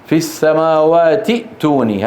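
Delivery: في السماوات ائتوني linked, with a glottal stop after ti; connected form. Shown as a demonstration. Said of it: "هذا في الوصل". (نطق «السماوات ائتوني» موصولًا بهمزة ساكنة)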